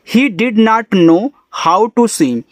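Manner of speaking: very slow, word-by-word English reading; slow reading aloud. Shown as a demonstration. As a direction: read each word out slowly for learners. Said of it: "reads sentence for class". (धीरे धीरे पढ़कर सुनाना)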